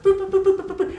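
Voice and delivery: tiny high-pitched booping; string of high, short boops; Baby Yoda. Retold or imitated. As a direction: make little creature noises way up high. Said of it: "Baby Yoda's like".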